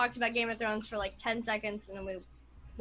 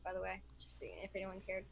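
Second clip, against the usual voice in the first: hushed snarky aside; whispered aside; 4 semitones lower, 15 dB quieter, faster than usual. Real, not acted. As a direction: whisper it under the breath.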